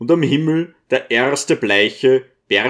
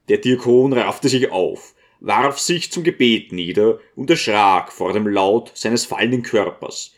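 ordinary delivery

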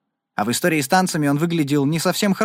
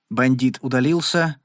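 ordinary delivery

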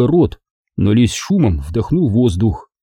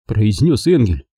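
normal talking voice

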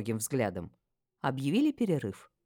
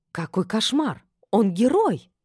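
high-pitched, excited exclamation; rapturous; 8 semitones higher, 6 dB louder, slower than usual. A narrator's reading. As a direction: gush over a hero, rising in volume. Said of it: "восторженно произнесла Сибилла". (восторженно, высоким голосом)

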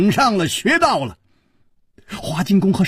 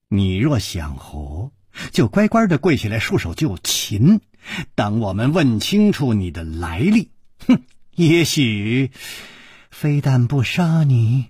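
put-on sneering old woman; 5 semitones lower than usual. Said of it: "花金公说".

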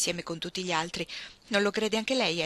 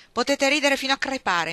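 louder, higher, indignant comeback; annoyed retort; 7 semitones higher, 7 dB louder than usual. A narrator's reading. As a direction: snap back at men laughing at you. Grady retorts to laughing men.

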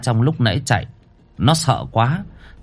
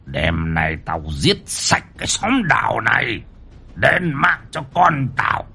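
put-on evil old sorcerer. A narrator's reading. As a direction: hiss out a vow of revenge. Evil Chinese sorcerer vows revenge.

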